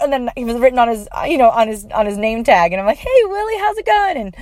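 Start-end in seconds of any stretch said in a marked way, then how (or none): 3.0-4.2 much higher sing-song hello; high excited hello; cheery greeting. Retold or imitated. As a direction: greet a friend you're thrilled to see. Greeting Willie cheerfully again.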